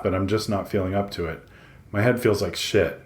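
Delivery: plain reading voice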